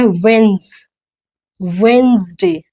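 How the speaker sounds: "वेंसडे" drawn out, WENZ, then WENZ-day; slow model pronunciation. Shown as a demonstration. Teacher drilling the hardest day, "वेंसडे" slowly as WENZ-day. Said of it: "ये सबसे ज्यादा problem होती".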